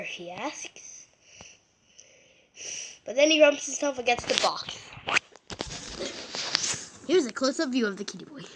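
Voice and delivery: solemn wildlife narrator voice; nature documentary. Acted. Kid narrates cats as documentary.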